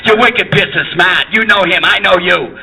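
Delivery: hammed-up Boston accent. Actors straining at Boston accents, hammed-up. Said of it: "working their ass off doing Boston accents".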